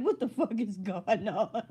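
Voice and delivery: slow, drawn-out disbelief; baffled reaction, dragged out slowly; retold bewilderment. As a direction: stretch it out, mock-baffled. Acting out reaction to cooking video.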